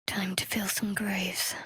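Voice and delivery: slow, grim whisper; menacing whisper. Acted. Cleric eyeing enemies before a fight, slow whisper. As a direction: promise death under your breath.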